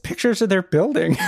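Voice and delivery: usual speaking voice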